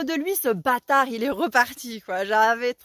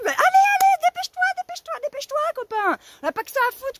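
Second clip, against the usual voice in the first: very high, loud frantic hurry up; impatient shout; 5 dB louder, faster than usual. Retold or imitated. Yelling at slow neighbour.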